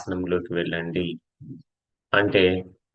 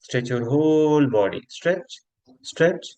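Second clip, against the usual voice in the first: yoga instruction; much slower than usual. Acted. Yoga teacher leading friends through a stretch, drawn-out cues. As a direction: stretch the words out with the movement.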